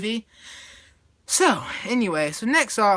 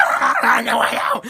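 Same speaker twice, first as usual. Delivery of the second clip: high, loud hammed-up shriek; mock scream; 7 dB louder than usual. Angry girl lunging over the table, mock scream. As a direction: scream it in a strained pretend rage. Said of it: "She's, like, screaming".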